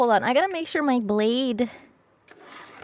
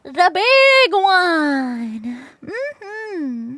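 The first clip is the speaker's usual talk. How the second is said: playful silly voice; 6 semitones higher, 6 dB louder, much slower than usual. Pretend. Playful voice naming big piece.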